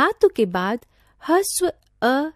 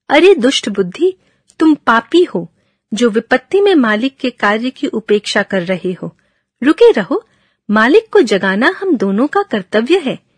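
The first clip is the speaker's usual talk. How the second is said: angry donkey; 8 dB louder, faster than usual. Acted. Donkey angrily scolds the dog for not barking, mock anger. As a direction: tell the dog off hotly. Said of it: "वह गुस्से से बोला".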